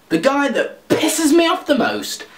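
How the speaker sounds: ordinary talk, nothing marked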